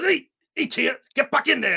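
high, loud cartoon cop scolding a tear; hammed-up cartoon cop voice. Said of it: "he goes".

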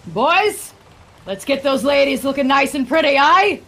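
high, loud rallying call; rousing shipboard yell; shout to crewmates. Acted. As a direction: holler orders across the gun deck. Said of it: "Jory shouts to his crewmates".

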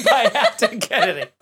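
words broken up by laughter; laughing. Real, not acted. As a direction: crack up while saying the name. Host laughs while crediting the submitter.

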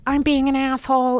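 high, loud fretful confession; imitating a needy fan; mock self-pitying whine. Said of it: "anything in that tone of voice".

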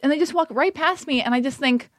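ordinary talk

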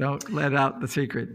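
usual speaking voice

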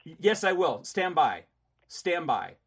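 loud, commanding stand by; angry. Real, not acted. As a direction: order her to wait. Heated argument with collector.

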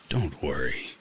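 slow, low, scratchy stranger; creepy stranger drawling the words. A narrator's reading. Creepy stranger continues speaking.

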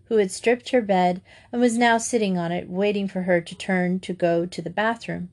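usual speaking voice

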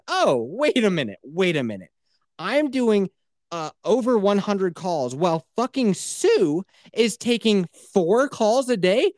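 high, slow, disbelieving rant; outraged at the numbers; incredulous outrage. Retold or imitated. Outraged at coworker's low numbers.

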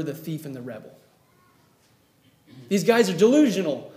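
normal talking voice

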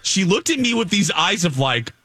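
ordinary talk

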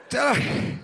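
strained sick uncle, slowing down at the end; hammed-up fading plea; pleading uncle. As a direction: urge the kid, then run out of air. Uncle repeats, weaker.

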